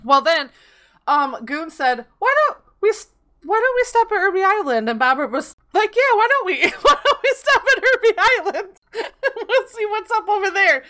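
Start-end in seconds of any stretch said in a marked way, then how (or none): 2.2-4.9 pitched up high, a lightly cartoonish friend; mock friend's voice. Friend's suggestion quoted in story. 5.9-10.8 high, giggling imitation of an eager buddy; buddy echoing the plan, high pitched and laughing; laughing mock agreement. Friend eagerly agreeing, quoted with laughs.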